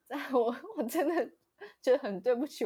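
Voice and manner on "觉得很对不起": usual speaking voice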